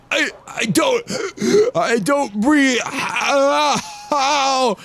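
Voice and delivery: high, gasping and strangled; choking being. Acted. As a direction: struggle for air on each word.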